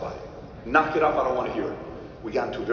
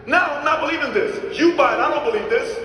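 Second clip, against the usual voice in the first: loud disbelief; 8 dB louder than usual. Acted. Mock disbelief rant opening.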